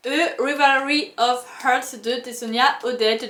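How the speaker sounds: book title read in a self-mocking English accent. Shown as a demonstration. Booktuber reads an English title to viewers, in an English accent. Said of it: "Toujours avec mon accent anglais incroyable".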